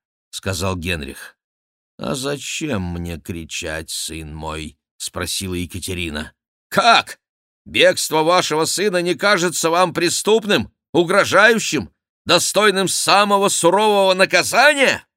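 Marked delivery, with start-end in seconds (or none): 2.0-4.8 slow, lower, soft voice; calm reply. A narrator's reading. Catherine answers her agitated son, calm and quiet. 6.7-15.1 high, loud, incredulous questioning; indignant outburst. A narrator's reading. Henri challenges his unmoved mother, indignant and loud.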